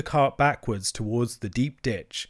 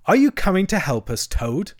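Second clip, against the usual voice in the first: raised, louder demand, dropping on the name; stern demand; 7 semitones higher, 5 dB louder than usual. Acted. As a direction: demand help with some sternness. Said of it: "he demanded sternly".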